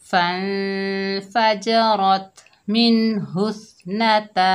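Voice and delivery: drawn-out, chanted tajweed reading; slow Quran recitation. Shown as a demonstration. Teacher demonstrating recitation to students, slow and chanted. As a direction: intone each syllable slowly like a Qari. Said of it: "ता मिलाकर पढ़ते हैं".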